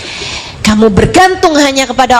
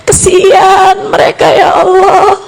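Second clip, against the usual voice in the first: crying prayer; 4 semitones higher than usual. Retold or imitated. Mother begging God for her children, crying plea. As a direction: cry out to God for your children. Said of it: "Dengan linangan air mata kamu berdoa".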